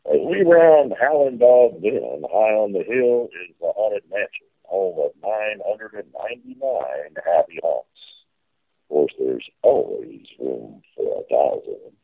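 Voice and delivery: riverboat captain spiel. Pretend.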